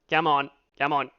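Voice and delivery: usual speaking voice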